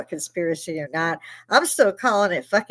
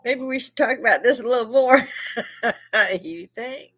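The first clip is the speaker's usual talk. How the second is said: amused retelling; 5 semitones higher than usual. Retold or imitated. Retells granddaughter's climb-down, laughing.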